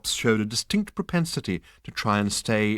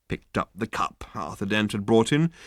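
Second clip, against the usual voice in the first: ordinary delivery, nothing marked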